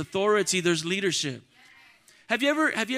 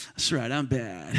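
low, slow, smug mock boast; swaggering low drawl, played for laughs; cocky self-admiration; 11 semitones lower, 3 dB quieter, slower than usual. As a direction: admire yourself in the mirror, drawing it out low. Preening at mirror after workout.

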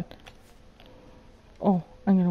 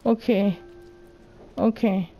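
ordinary talk

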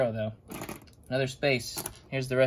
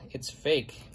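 ordinary talk

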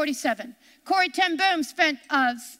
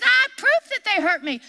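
proudly insisting she has the evidence; excited claim; 6 semitones higher, 4 dB louder than usual. Retold or imitated. Retelling Corrie eagerly telling her friend she has proof, high excited voice. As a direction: voice jumps up high in eager triumph. Corrie triumphantly citing old letters.

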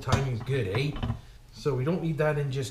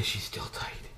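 whisper; 12 dB quieter, slower than usual. Real, not acted. Struggling to fit the bearing.

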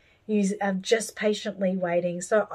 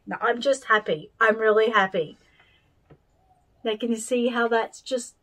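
ordinary talk

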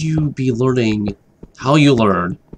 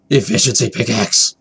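excited gasp. Real, not acted. Gloating over loot.

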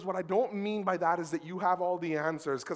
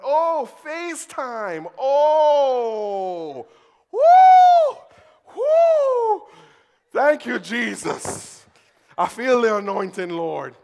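ecstatic relief; 8 semitones higher, 16 dB louder, much slower than usual. Retold or imitated. Relieved praise after phone scare.

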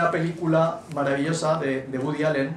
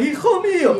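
hijo mío in a high, loud, hammed-up wail; mock anguished mother; 6 dB louder than usual. Mother's dramatic cry over child. (madre exagerada, lamento alto y fuerte, medio en broma)

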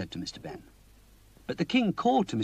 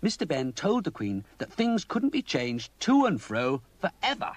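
ordinary delivery, nothing marked

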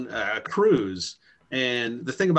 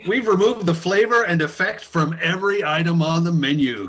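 higher, louder cheesy ad voice; mock commercial announcer; 7 semitones higher, 5 dB louder than usual. Parodying an Applebee's ad to friends, cheesy announcer voice. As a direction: sell it like a TV spot.

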